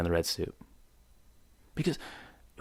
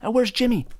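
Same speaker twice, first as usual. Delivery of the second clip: calling out; 13 semitones higher, 5 dB louder than usual. Acted. Worried parent call.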